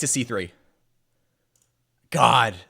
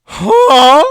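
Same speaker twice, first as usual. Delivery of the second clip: shocked loud huh; 11 semitones higher, 15 dB louder than usual. Real, not acted. Shock at engine line.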